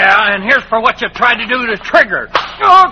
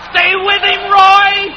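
cheering shout; 7 semitones higher, much slower than usual. Acted. Cheering rider again.

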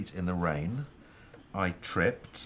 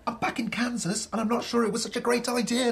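high-pitched exclamation; high, agitated outburst; worked-up agreement; 13 semitones higher, 3 dB louder than usual. Acted. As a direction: burst out high and exasperated.

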